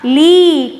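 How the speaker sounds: "ली" with a loud, long-held ee; long-vowel demonstration. Shown as a demonstration. Teacher answering how it is pronounced, "ली" drawn out long. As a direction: stretch the ee in "ली", projecting it. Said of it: "इसका उच्चारण कैसे होगा?".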